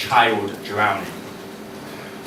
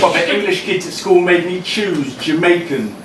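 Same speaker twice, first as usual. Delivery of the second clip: raised, ringing performance poem voice; spoken word poem; 6 semitones higher than usual. Acted. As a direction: deliver each line to the room like a slam poem.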